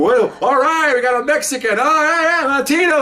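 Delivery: high, loud whooping fangirl; mock a drunk woman cheering wildly; imitated screaming. Women hollering at a Mexican dancer onstage, imitated excited screaming. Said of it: "all these women are screaming".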